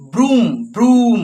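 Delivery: ব্রুম with a long, stretched "oo"; drawn-out long vowel. Shown as a demonstration. Teacher demonstrating the long phonic sound, drawn-out ব্রুম. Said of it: "একটু টান করে হচ্ছে না".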